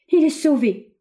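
very high, loud exclamation of relief; joyful cry. A narrator's reading. Young woman seeing the old man revive, joyful cry. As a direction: cry out the good news joyfully. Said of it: "s'écria joyeusement Ophélia".

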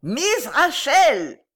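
high, loud, very slow yell; shouting. A narrator's reading. Informer crying into the old lady's ear, shouting. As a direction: bellow the name syllable by syllable. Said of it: "cria dans l'oreille de la vieille lady".

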